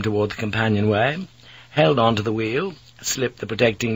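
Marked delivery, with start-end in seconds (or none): none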